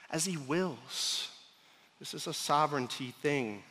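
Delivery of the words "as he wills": As he wills, said softer and drawn out; slowed, hushed repeat to drive it home; slow quiet emphasis. Acted. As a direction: let the words sink in, quietly. Repeats the verse to land it.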